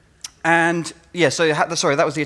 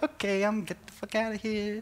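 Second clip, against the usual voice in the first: hushed panic; 8 semitones higher, 7 dB quieter than usual. Retold or imitated. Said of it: "you're like".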